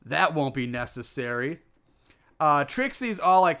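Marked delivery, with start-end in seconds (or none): 0.0-1.6 creepy villain voice. Retold or imitated. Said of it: "Creepy Harold pops up and says".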